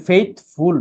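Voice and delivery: "ফেথফুল" slowly split as faith-ful; slow syllable demo of "ফেথফুল". Shown as a demonstration. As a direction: say "ফেথফুল" as two drawn-out beats. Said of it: "একের অধিক".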